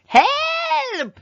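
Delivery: high, loud wailing call from afar; distant cry for help. A narrator's reading. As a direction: cry out for help from far away. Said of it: "came a cry".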